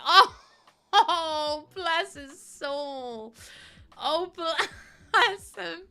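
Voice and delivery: high, laughing, tender; laughing aww. Real, not acted. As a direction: laugh through the sympathy. Reacting tenderly while laughing.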